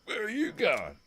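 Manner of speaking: high, mocking road-worker voice. Telling his mate about the road worker who stopped him, high mocking voice. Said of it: "Comes out".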